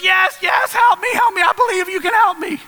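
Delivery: acted high-pitched frantic begging; desperate pleading. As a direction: plead for rescue at the top of your range. Man on cliff begs God.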